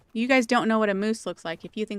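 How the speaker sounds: ordinary delivery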